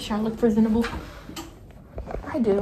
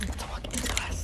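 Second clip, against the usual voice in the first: whisper; 6 dB quieter, faster than usual. Real, not acted. About to enter class.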